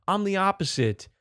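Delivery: ordinary delivery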